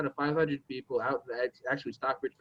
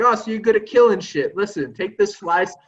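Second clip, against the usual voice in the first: landlord; 4 semitones higher, 7 dB louder than usual. Retold or imitated. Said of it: "He's like".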